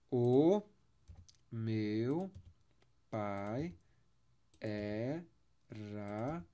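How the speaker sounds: each syllable of O meu pai era paulista spoken apart with long pauses; separated syllables. Shown as a demonstration. Teacher splitting the line into syllables, slow and separated. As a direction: break it into grammatical syllables. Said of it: "eu fiz a separação de sílabas gramaticais".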